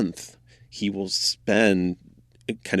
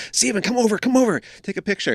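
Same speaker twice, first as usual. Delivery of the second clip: high-pitched, slightly louder mock yell; urgent call-over, quickly retold in a raised, high voice; imitated yell; 13 semitones higher, 4 dB louder, faster than usual. Guide quoting an assistant calling the photographer to the elephants, mock yelling. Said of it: "basically had to yell at him".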